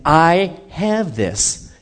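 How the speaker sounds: slow, measured assurance; God's voice. Retold or imitated. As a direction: speak as a calm God taking charge. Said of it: "God is saying".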